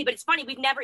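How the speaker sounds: ordinary talk, nothing marked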